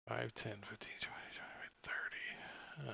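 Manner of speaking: whispered count to oneself; very soft, absent counting; counting under breath. Real, not acted. Counting squares to himself.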